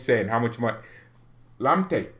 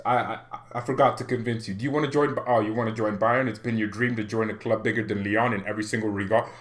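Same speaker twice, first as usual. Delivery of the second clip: normal talking voice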